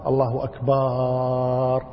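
أكبر held long on its last vowel, level chant; faulty stretched ending. Shown as a demonstration. Teacher imitates ignorant callers, last vowel stretched. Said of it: "الذي يقول مثل هذه الألفاظ".

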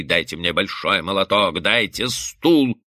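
usual speaking voice

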